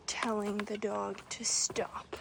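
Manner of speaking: hushed whisper. Real, not acted. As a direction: whisper it, wary of the dog. Nervous hiker near strange dog.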